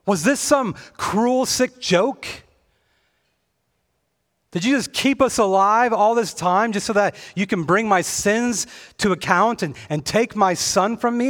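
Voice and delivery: high, loud, pained protest of a bereaved mother; anguished grieving widow. Acted. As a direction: cry out at the prophet in raw grief, high and loud. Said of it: "she has this visceral reaction".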